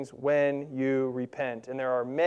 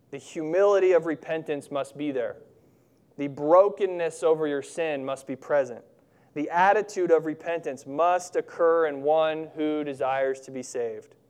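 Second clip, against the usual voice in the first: normal talking voice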